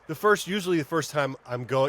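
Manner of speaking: usual speaking voice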